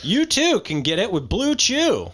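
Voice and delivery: hammed-up infomercial testimonial; porn commercial pitch. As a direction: pitch it like a cheesy commercial spokesman. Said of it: "turned into one of those porn commercials".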